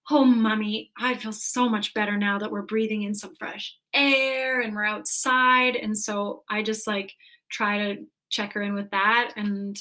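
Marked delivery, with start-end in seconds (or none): 0.1-5.8 put-on gushing little-kid relief, louder on the opening and ending; imitated delighted child. Daughter telling mom about fresh air, imitated delight. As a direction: act out a child's wide-eyed delight, drawing out the end. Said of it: "her eyes go even bigger".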